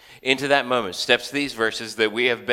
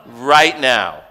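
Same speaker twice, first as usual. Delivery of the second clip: exasperated parent; 5 semitones higher, 7 dB louder, slower than usual. Retold or imitated. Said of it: "I'm like".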